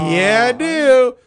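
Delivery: loud, high, drawn-out delight; acted eager yes. Regular accepting free ice cream, loud eager acted reply. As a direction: belt it out slowly, comically thrilled.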